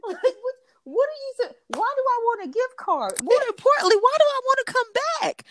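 high, incredulous, laughing rant; can't believe what you're hearing; laughing disbelief. Retold or imitated. Friend imagines hearing about the arm, laughing disbelief.

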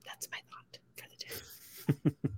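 soft unvoiced whisper, slowed right down. Real, not acted. Host whispers her closing thought to listeners. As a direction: breathe the sign-off line like a secret.

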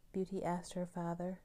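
usual speaking voice

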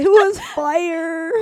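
slow, high, loud laughing it was fire; laughing mock quote. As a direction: drag it out mockingly while laughing. Mocking friend's old words.

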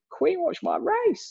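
brash show-off telling off royalty; cheeky cocky quote. Retold or imitated. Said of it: "I would have just been".

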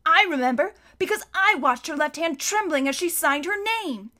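high-pitched, eager recollection; excited. A narrator's reading. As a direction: blurt out the proof eagerly. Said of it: "Klaus said excitedly".

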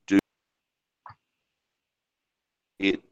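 audio cutting out; choppy call audio; bad connection. Real, not acted. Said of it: "Sorry, you cut out again".

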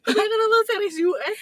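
real laughing explanation. Real, not acted. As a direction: giggle through the line.

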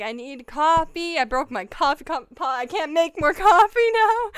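high, loud, tearful whine; devastated whine. Retold or imitated. Grieving the broken coffee pot to a friend, tearful whine. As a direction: plead like a child who lost a treat. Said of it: "I was just so sad".

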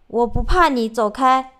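loud defiant shout. Retold or imitated. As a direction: yell it to scare something off. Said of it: "她大声地喊道".

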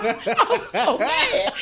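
slow, laughing words; stretched out through laughter. Real, not acted. Laughing, slowed retelling.

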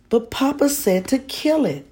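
put-on high, loud child's protest; a boy desperately pleading. A narrator's reading. Boy protesting to mother, high and pleading.

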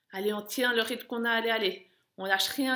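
loud pushing shouts, urging them on; barking gym coach. Real, not acted. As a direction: shout them through the last stretch. Coach pushing runners final seconds.

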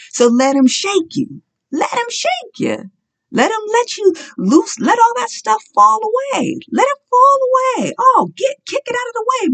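high, full-force preaching cry; fiery preaching. Acted. Preacher urging the congregation to let God shake them, in a fiery high cry. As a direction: preach it out at the top of the voice.

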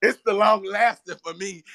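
loud laugh-filled exclamation. Real, not acted. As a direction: burst out laughing on it. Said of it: "he said it's the long-lasting for me".

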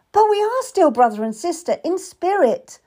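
insisting he's still her brother, voice up high; cried out. A narrator's reading. Kat cries out to Will, high and pleading. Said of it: "I cried".

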